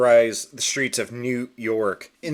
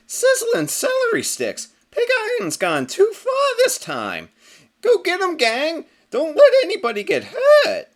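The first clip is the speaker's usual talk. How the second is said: very high, louder, eager squeaky hero; cartoon hero rallying cry; 5 dB louder than usual. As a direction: lead the charge like a bouncy rabbit hero. Captain Carrot springs into action.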